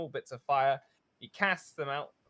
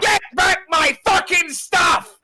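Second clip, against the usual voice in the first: much higher and louder, slow bellow; furious captain shout; 14 dB louder than usual. Acted. Captain roaring at his crew to get his stuff back. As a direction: spit out the order in rage.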